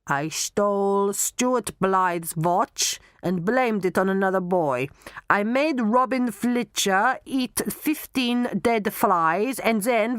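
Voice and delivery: put-on mounting German-accented list of misdeeds. Knockman confessing all his nasty deeds, German accent. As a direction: reel off the crimes in a thick German accent. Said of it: "you will talk in a German accent".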